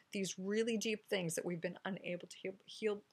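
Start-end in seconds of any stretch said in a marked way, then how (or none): none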